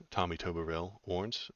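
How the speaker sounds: ordinary talk, nothing marked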